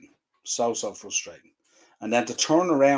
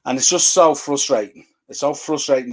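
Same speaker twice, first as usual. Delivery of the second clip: frustrated outburst; 4 semitones higher, 7 dB louder than usual. Real, not acted. Ranting about his club.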